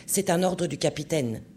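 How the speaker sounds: usual speaking voice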